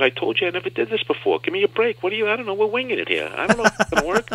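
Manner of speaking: throwing up his hands, voicing the doctor; exasperated surgeon. Surgeon to patient, exasperated and high. Surgeon defensively admitting improvisation.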